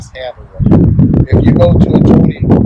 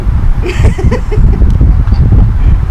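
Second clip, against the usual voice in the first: high laugh. Real, not acted. Laughing at the memory.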